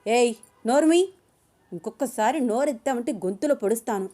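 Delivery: loud barked shut up, then menacing; man threatening the struggling woman; stern warning. Acted. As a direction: silence her with a threat. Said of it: "warning ఇచ్చాడు అభిరామ్".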